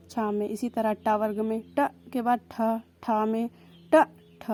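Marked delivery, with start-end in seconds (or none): 3.9-4.4 ट short, ठ aspirated, both louder; letter sounds demo. Shown as a demonstration. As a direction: snap ट then breathe out ठ. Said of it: "ठा में थोड़ा टाइम लग रहा है".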